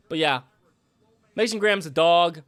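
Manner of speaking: ordinary delivery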